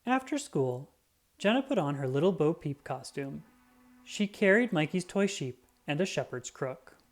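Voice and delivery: usual speaking voice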